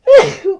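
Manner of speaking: a sudden loud, real cough; real cough from a cold. Real, not acted. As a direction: cough hard, caught off guard.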